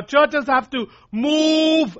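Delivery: Churches have to move, with "move" drawn out slow, high and loud; stress on move. Real, not acted. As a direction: lean hard on "move", slow and a little tearful. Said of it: "I'm really putting the emphasis on that word".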